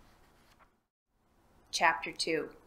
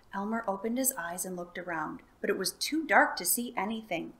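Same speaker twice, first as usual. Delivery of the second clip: plain reading voice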